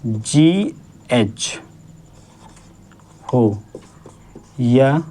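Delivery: very slow, word by word; drawn-out teacher dictation. Shown as a demonstration. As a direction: spell out the rule slowly for the class. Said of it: "आखिर में जब हमारा".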